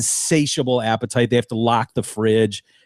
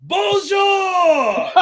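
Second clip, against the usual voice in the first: much higher, shrieking mock French greeting; screamed bonjour. Man greeting the neighborhood from his window, high mock scream. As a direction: belt it out the window at the whole street. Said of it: "screams to the neighborhood".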